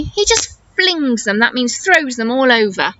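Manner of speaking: ordinary delivery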